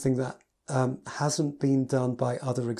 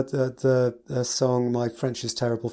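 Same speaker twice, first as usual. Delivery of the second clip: ordinary talk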